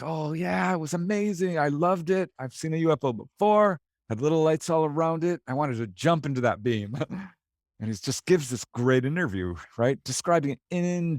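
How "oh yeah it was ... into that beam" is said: high-pitched imitation of a thrilled witness; imitated excited gushing. Witness gushes to interviewer about the UFO sighting, imitated excited gushing. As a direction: gush in a raised voice, as the witness did.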